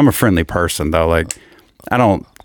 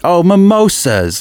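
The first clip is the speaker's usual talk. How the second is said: mock fancy; 9 semitones higher, slower than usual. Mocking a fancy brunch drink.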